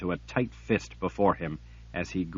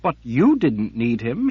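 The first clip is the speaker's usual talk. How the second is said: urgent, insistent higher voice; pressing woman's voice; 4 semitones higher, 6 dB louder than usual. Acted. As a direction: push the point hard. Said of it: "she pressed".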